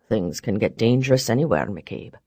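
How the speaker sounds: deep, quiet, cool retort; low calm man voice. Acted. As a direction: throw it back coldly. Barrons counters calmly.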